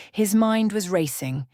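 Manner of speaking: ordinary delivery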